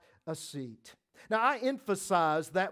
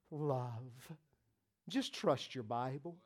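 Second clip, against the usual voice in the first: much softer, sincere; tender hush; 6 semitones lower, 9 dB quieter than usual. Acted. Preacher gently urging trust in the Bible, soft.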